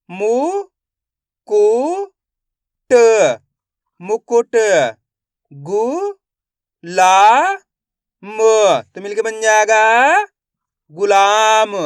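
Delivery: loud separate syllables, then blend the word; syllable by syllable phonics. Shown as a demonstration. Teacher sounds out syllables loudly for the class. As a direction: sound out each syllable for young learners.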